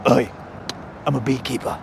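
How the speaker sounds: low, soft English tough guy; English accent. Acted. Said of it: "You're butchering the English accent".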